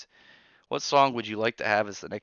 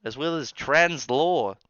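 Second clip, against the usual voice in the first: higher, louder, slowing at the end in a mock boast; mocking Spider Side; 5 semitones higher, 7 dB louder than usual. Streamer mocking a viewer's Attack on Titan fandom, hammed-up higher voice. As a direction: puff up like a smug anime fan. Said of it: "He'll go".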